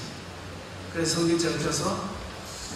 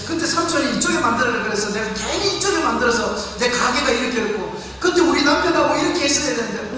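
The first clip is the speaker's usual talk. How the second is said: regretful lament; 4 semitones higher than usual. Retold or imitated. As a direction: whine through every regret in a rush. 후회하는 성도들의 하소연 흉내. (후회하며 하소연하는 목소리)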